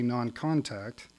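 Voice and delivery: non-contact drawn out slowly with a knowing fall; wry, slow non-contact; ironic stress. Shown as a demonstration. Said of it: "and I say ostensibly".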